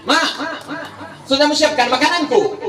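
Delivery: a husband asking his wife, high and laughing; half-laughing imitation of the husband; preacher lightly voicing a husband at home; mock husband.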